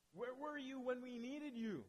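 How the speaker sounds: slow, drawn-out retold lament, loud and high; anguished cry. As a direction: cry it out like an abandoned believer. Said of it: "it's coming with sobs and tears".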